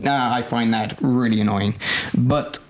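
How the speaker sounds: normal talking voice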